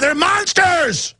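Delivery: high, slow shout of monsters; slow shouted outrage; angry shout. Real, not acted. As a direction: drag the word out, outraged. Ranting about elites.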